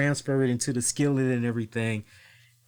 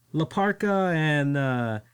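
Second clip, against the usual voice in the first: ordinary talk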